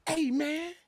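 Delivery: hint of a nervous man's trembling voice; lightly imitating a shaky, timid delivery; quivering voice. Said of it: "remember his voice is quivering?".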